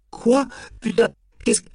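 very high, spluttered outcry; aghast, pitch shooting up; shocked disbelief. A narrator's reading. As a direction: blurt it out in outrage. Suspect shocked by the accusation.

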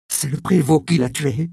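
loud, high, insistent, stress on prévôt; insisting angrily. Acted. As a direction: hammer the accusation home. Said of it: "insista la jeune fille". (insistance furieuse, fort et aigu)

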